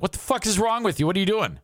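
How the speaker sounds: high, fast, mock-angry outburst; mock outrage. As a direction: snap at him in fake disbelief. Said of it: "I'll be like".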